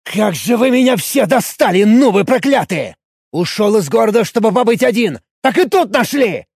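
high-pitched, exasperated tirade; ranting yell. A narrator's reading. Warrior fed up with pestering noobs, ranting yell. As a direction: keep shouting, utterly fed up. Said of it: "не прекращая кричать".

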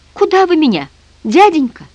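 lightly voiced small boy, pitched high; high, plaintive child's question; timid little boy. A narrator's reading. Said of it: "тихо спросил Митя".

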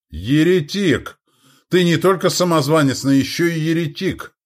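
high, strained outcry; exclaimed accusation. A narrator's reading. Uncle attacking the king, crying heretic. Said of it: "воскликнул дядя".

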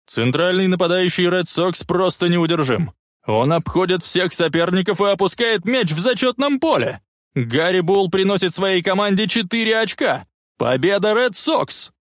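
radio commentator announcing the winning score; excited play-by-play. A narrator's reading.

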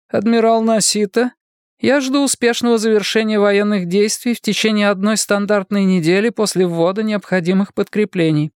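high-pitched voice of a woman in command; decisive woman leader. Acted. Voices Gladstone giving orders. (властная руководительница, высокий голос)

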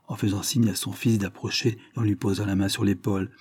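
ordinary delivery, nothing marked

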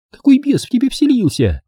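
high-pitched alarmed question; wailing protest. A narrator's reading. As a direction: cry it out, pitched well up. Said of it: "завопила она".

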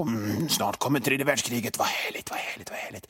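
soft, then whispered, savouring war; dreamy gloating whisper. Retold or imitated. A prepper raking his lawn dreams of world war, gloating whisper. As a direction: hush it to a delighted whisper. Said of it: "bara funderar på".

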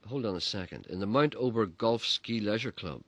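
ordinary talk, nothing marked

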